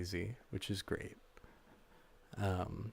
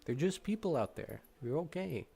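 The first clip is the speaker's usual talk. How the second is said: talking to a dog; 6 semitones higher than usual. Real, not acted. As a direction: soothe the puppies gently. Soothing the barking dogs.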